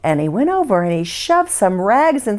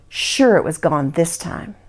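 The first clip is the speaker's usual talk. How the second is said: plain reading voice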